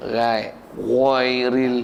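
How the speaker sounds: "ghairil" very slow, the gh stretched deep in the throat; careful ghain. Shown as a demonstration. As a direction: drag out the throaty gh of "ghairil" syllable by syllable. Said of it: "Ghain tu". ("ghairil" disebut sangat perlahan dengan ghain tekak yang panjang)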